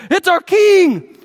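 high, loud, slow cry of praise; crowd acclaim. Retold or imitated. Preacher voicing the crowd hailing their king, loud slow high cry. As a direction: hail him like a cheering crowd. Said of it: "they went from".

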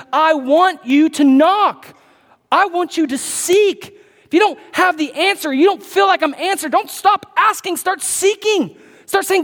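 high, insistent preacher delivery; urgent preaching. Real, not acted. Preacher urging the church to keep seeking God, urgent preaching.

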